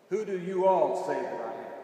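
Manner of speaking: firm push on "you all", then easing off; stressed YOU ALL. Shown as a demonstration. Preacher repeating Jesus' question to the disciples, "you all" stressed. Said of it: "It's emphatic, and it is plural".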